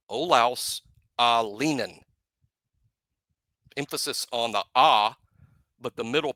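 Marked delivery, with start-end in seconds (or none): 0.1-2.0 Olaus Alinen spelled out in sound, slow, AH-lee-nen; slow demonstration. Shown as a demonstration. Host demonstrates slowly for listeners, careful AH-lee-nen. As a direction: drag out Olaus Alinen syllable by syllable. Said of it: "That is how his folks say his name".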